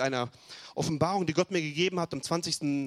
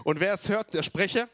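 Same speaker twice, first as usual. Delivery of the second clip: louder, pushing the call with more drive; more energy; 5 semitones higher, 4 dB louder than usual. Shown as a demonstration. Preacher rallying the congregation for a livelier response, louder. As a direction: read it again with real punch. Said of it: "ein bisschen mehr Elan".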